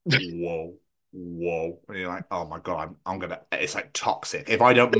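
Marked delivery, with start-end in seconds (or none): 0.1-1.8 low, soft drawn-out whoa; low mock alarm from the bladder. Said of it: "your bladder's like". 2.3-3.4 panicked mutter. Retold or imitated. Panicked waking need to pee.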